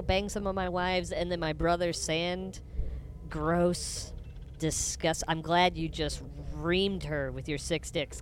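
usual speaking voice

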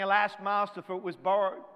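urgent cry. Retold or imitated. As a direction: call out in urgency.

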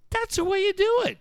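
very high-pitched, excited cheer. Retold or imitated. Friend excited for the pool builder, high excited cheer. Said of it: "I'm so excited for him".

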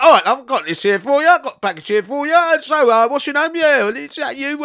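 high, silly delivery-man voice; hammed-up prankster at the door; mock prank caller. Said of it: "he always like".

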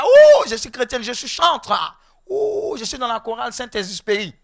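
lightly mimicked chorister with a long "ouh"; hint of a showy believer; mock boastful churchgoer.

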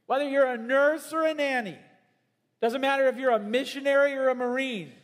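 high, loud exhortation; fervent preaching. Acted. Preacher exhorting congregation, high and loud. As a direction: urge the congregation with fire.

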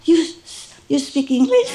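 high, amazed and laughing; laughing surprise. Retold or imitated. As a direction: ask in laughing disbelief. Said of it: "I was so surprised".

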